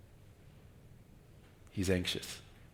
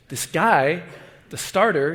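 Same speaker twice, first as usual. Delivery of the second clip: ordinary talk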